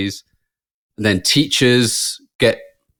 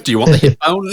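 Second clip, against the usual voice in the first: laughing mock offer; 4 semitones higher, 3 dB louder than usual. Mock doctor offering hip bone.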